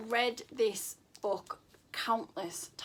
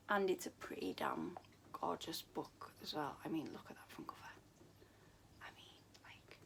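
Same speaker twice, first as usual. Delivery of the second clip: soft near-whisper of awe; whispering in admiration; hushed aside; 5 semitones lower, 12 dB quieter, faster than usual. Real, not acted. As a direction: drop to a breathy hush over the cover. Gushing over the book's beauty.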